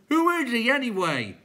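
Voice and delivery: high, loud creature objection; newcomer clown. A narrator's reading. Newcomer answering the others, high loud squawk. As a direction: squawk it out puzzled.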